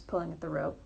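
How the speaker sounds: usual speaking voice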